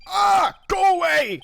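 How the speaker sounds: loud, high, drawn-out shout; real irritated shout, pitch leaping up; exasperated yell. Real, not acted. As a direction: yell at the interruption to go away. Yells at interruption.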